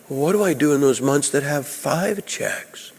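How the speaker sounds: scared student asks the preacher about extra checks, timid and soft; worried student. Retold or imitated. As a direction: ask it small and nervous, like a scared kid. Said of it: "this guy got all scared".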